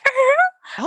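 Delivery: high excited cry of a name; overjoyed, calling the name up high. Retold or imitated. Mandy greets Earl, high excited call.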